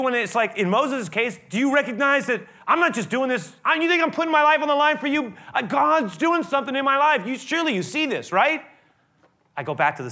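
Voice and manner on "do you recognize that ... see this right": pleading, rising. Retold or imitated. Moses pleading his case.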